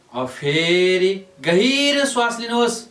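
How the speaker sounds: slow, high, elongated vowels. Real, not acted. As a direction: pull each word out with the breath. Coaching a deep inhalation.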